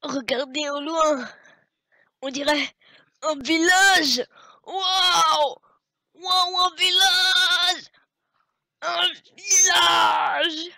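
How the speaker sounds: high, strained, loud, wonder-struck voice; high put-on voice. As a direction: marvel at the village in a high voice forced to the point of straining the throat. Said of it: "cette voix me fait toujours mal à la gorge". (voix aiguë forcée qui émerveille devant le village)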